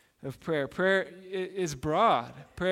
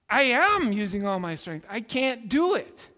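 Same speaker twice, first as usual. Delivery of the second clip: son protesting, louder and higher, slowing on the last words; frustrated boy; 4 semitones higher, 5 dB louder than usual. Retold or imitated. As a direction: push back at dad, nearly yelling. Son insists to father.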